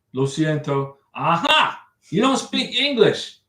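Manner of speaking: ordinary talk, nothing marked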